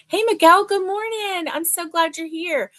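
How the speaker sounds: high, loud opening, bubbly welcome; cheery greeting. Real, not acted. Host welcomes a viewer joining mid-story, delighted. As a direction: greet a friend who just walked in.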